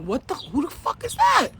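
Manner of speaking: high, startled shout; retold crowd outcry. Imitating onlookers spotting a hidden stranger, alarmed. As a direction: mimic a stunned crowd calling out. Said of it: "the whole place was like".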